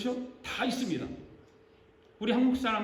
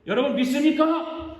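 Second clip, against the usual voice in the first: loud, high preacher's question; revival-style shout to the crowd; rallying call; 6 semitones higher, 6 dB louder than usual. Shown as a demonstration. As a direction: rouse the congregation to answer. Preacher calls for congregation's amen.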